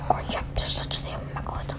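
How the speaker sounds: fast urgent whisper. Real, not acted. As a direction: hiss it under your breath, fast. Urging game along, voice drops.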